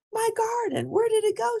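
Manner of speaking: high, upset call; dismayed gardener, pitched high; shocked cry. A narrator's reading. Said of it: "she yelled".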